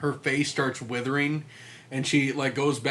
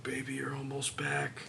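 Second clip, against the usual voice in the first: softer whispered line to a corpse; much softer mock creepy whisper; 12 dB quieter than usual. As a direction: whisper it to the dead wife.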